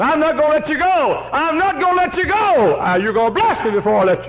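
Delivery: very high, loud impassioned Jacob; desperate cry. Acted. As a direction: wrestle and refuse to let go. Jacob wrestling the angel.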